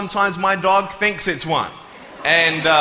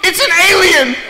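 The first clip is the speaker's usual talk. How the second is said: put-on very high, loud child's shout; high-pitched yelling small girl; alarmed little girl; 13 semitones higher, 7 dB louder than usual. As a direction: shriek it to mommy like a startled kid. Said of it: "there was this one girl who saw me, and she goes".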